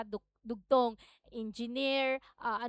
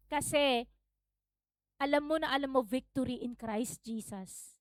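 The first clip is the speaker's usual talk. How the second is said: usual speaking voice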